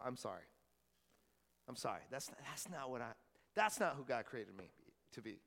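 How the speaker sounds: low, hushed, tearful apology; quietly crying, with pauses to hold it together; choked up. Real, not acted. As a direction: apologize softly, on the verge of real tears. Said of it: "you got me crying up in this church".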